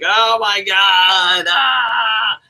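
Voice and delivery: slow, loud mock scream of fright; mock terrified yelp. Seth Rollins playing scared, mock shriek. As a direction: ham up a terrified wrestler. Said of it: "He's just like".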